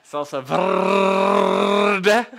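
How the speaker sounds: "verde" with a hugely long, loud trilled R; high, very slow, booming "salsa verde" with endless rolled R; drum-roll R. Shown as a demonstration. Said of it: "put a drum roll emoji".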